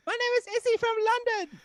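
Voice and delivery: very high mock London accent; Izzy's London accent. Girl introducing herself to a Texan, high London accent. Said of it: "And she goes".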